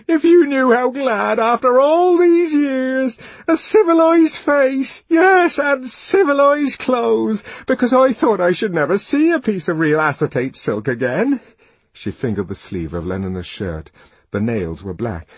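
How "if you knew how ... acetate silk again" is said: high, loud, tearfully gushing woman; sobbing torrent. A narrator's reading. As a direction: pour it out in an overwrought, hammed-up wail. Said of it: "The torrent of words flowed sobbingly".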